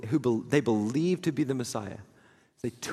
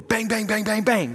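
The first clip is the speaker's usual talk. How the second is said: knocking imitated; 7 semitones higher, 4 dB louder than usual. Banging at the door.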